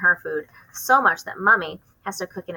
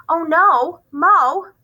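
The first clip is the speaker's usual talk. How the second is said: high, loud alarmed cry; girl startled as the dragon slips in; 10 semitones higher, 8 dB louder, much slower than usual. Acted. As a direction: blurt it out in panic. Child alarmed at dragon appearing.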